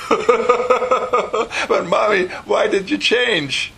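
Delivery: high-pitched, put-on daughter voice; pleading child. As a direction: plead upward like a child to Mommy. Acted child questioning her mother.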